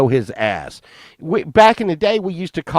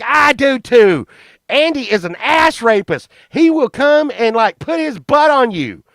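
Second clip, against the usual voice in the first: mock earnest accusing; 8 semitones higher than usual. Boy joins Miss Bounds accusing his friend, mock earnest. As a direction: back her up with hammed-up alarm.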